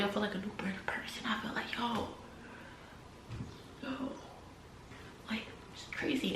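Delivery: breathy whispered awe; hushed whisper. Real, not acted. Telling listeners of rebirth, hushed whisper. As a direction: whisper it like a secret.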